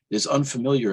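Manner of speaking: plain reading voice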